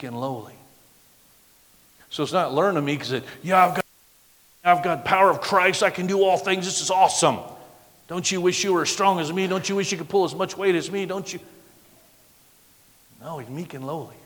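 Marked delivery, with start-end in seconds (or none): 3.4-11.4 loud, higher-pitched show-off; crowing mock self-congratulation; mock boasting. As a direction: brag it up like a hammed-up strongman. Mimics a boastful, proud believer.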